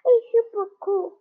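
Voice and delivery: jaguar pet voice. Acted. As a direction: play a cute little jaguar. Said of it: "this is what she sounds like".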